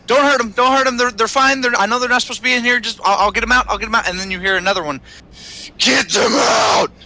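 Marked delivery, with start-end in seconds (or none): 0.1-4.1 higher, rushed pleading NPC. Acted. GM voices a frantic NPC begging to spare the players. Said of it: "One says". 5.8-6.9 slow, loud shouted command. Acted. GM voices a violent man yelling at intruders, loud shout. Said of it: "that was really loud into the mic".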